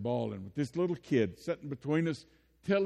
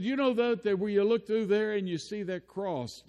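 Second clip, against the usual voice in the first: put-on higher, louder, eager little boy voice; child's voice; 8 semitones higher, 4 dB louder than usual. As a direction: play the excited three-year-old pointing out the cross. Little boy describing the crosshairs.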